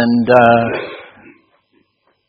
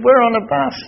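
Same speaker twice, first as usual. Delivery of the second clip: imitated woman's high-pitched objection; incredulous protest; 11 semitones higher than usual. Russian teacher objecting to a concert on board, high disbelief. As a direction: protest high, as if it's absurd.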